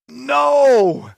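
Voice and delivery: sudden loud "No!" as the cop appears; retold dismayed cry. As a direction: cry out in dismay at getting caught. Seeing the cop waiting.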